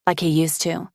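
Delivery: usual speaking voice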